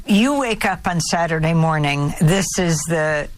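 normal talking voice

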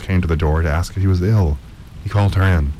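plain reading voice

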